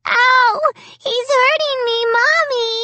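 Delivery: put-on very high-pitched wail of a small child in pain; squealing, whiny hurt kid; hurt little girl. A narrator's reading. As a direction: cry out to mommy, high and loud.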